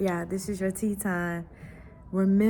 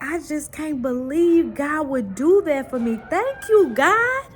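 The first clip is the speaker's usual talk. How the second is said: climbing much higher and louder to a thrilled thank you; overjoyed child; 7 semitones higher, 5 dB louder than usual. Retold or imitated. Daughter thanking God for the power, high louder overjoyed child voice. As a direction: build the girl's joy to a high cry.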